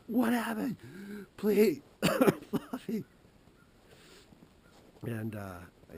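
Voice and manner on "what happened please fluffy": very high, slow, loud wailing; sister's wail. Retold or imitated. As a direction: wail the cat's name, drawn out. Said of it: "she's just like".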